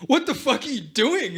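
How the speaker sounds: high, loud mock outrage; hammed-up high angry cry; outraged. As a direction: squawk it in disbelief. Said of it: "he's just like".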